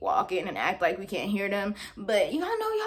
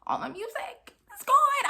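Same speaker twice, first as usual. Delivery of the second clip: very high, drawn-out mock wail; upset wail; 13 semitones higher than usual. Student mourning the lost iPod, wailing upset. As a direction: lament it in a slow, high squeal. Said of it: "I was really upset 'cause".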